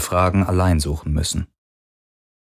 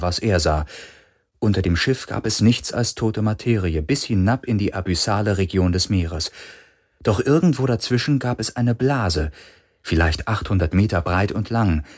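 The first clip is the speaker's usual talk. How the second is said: ordinary delivery, nothing marked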